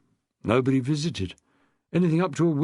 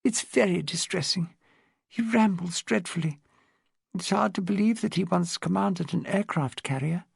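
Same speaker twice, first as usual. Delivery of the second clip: put-on higher, refined elderly upper-class voice; posh old lady; 4 semitones higher than usual. Old lady tells Rose about her husband, posh elderly voice. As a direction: speak like a frail, well-bred widow of seventy. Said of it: "in an accent redolent of a privileged upbringing".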